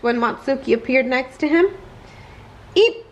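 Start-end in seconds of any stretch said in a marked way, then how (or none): 2.7-3.0 squeak. Acted. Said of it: "Matsuki replied".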